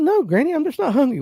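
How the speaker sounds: put-on a polite kid brushing off the offer; kid answering granny. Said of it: "I'm like".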